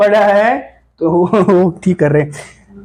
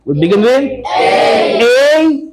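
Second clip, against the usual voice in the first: slow drill; 2 semitones higher, much slower than usual. Shown as a demonstration. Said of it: "teacher drilling slowly".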